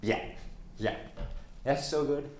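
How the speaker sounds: ordinary delivery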